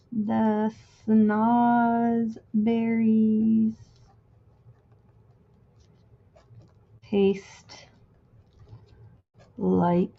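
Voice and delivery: very slow, one word at a time; drawn out while writing. Real, not acted. Crafter lettering the snozberries quote to herself, drawn out. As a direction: stretch the words with long gaps, half to yourself.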